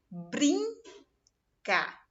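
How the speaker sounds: "Brincar" said stretched, nasal in held; sound shown. Shown as a demonstration. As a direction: lean on the in of "Brincar". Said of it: "e a outra com N". ("Brincar" com o som nasal prolongado)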